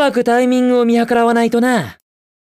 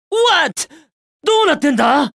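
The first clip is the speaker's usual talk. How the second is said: sharp high "What?" of surprise; startled outcry, pitched way up; surprised; 5 semitones higher than usual. Acted. Hero startled by a sudden trap, high surprised cry.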